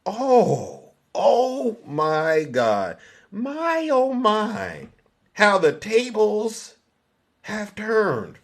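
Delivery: high, drawn-out theatrical gloating; mock shock. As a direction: savour it slowly in a high, hammed-up voice. Gloating over reversed accusations.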